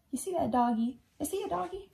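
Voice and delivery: plain reading voice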